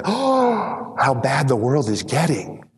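high, drawn-out "oh" of feigned shock; mock dismay. Churchgoers in a bubble lamenting the world, mock dismay. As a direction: put on a sheltered churchgoer's lament. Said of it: "sit in this bubble and say".

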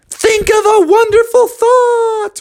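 slow, very high frog squeal; excited lift-off. Acted. Froggy willing himself into the sky, high drawn-out squeal. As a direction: stretch the words high, then a long whoa.

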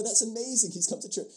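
high, delighted mock wonder; excited, high-pitched marvelling; imagined amazement. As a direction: imitate a thrilled churchgoer. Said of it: "one would hope that people would go".

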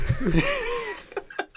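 high-pitched laughing fit; helpless high laugh; real laughter. Real, not acted. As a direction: crack up, high. Cracks up at the joke.

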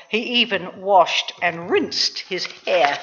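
usual speaking voice

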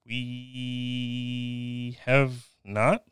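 very slow, teasingly stretched answer; hugely drawn out. Real, not acted. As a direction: stretch "We" out very long. Playful drawn-out answer to question.

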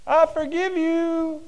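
high, slow, ringing forgiveness; merciful master forgiving his debtor; drawn-out mercy. Acted. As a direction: stretch it out with grace. Master forgiving debt, said twice.

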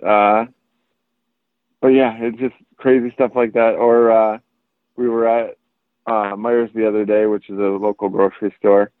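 ordinary talk

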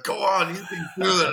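mock urgent encouragement; egging someone on. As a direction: cheer them on, high and loud. Acted egging-on of a singer.

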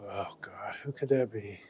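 unvoiced, searching for a name; whispering to himself. Real, not acted. Trying to recall a musician, whispering. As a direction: whisper while racking his memory.

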